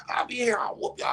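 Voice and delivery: high unvoiced crying-laugh threat; empty threat he can't hold; squeaky half-sobbed warning; breaking mock threat.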